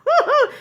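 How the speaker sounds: high delighted cackle; real high laugh; burst of laughter. Real, not acted. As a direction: break into a gleeful laugh. Laughing at her own story.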